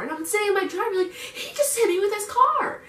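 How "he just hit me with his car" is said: very high, exasperated insistence; frantic retold outburst. Caller in the driveway telling a friend, frantic. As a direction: insist it in a high-pitched, worked-up voice.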